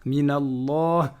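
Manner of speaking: Minallah slow, the lam-alif vowel held; long Allah. Shown as a demonstration. Teacher showing the hidden prolongation, drawn out. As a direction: stretch the unwritten long a in Minallah. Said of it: "prolongation qui n'est pas écrite".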